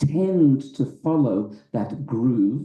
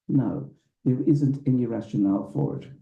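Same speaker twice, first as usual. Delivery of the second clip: ordinary talk, nothing marked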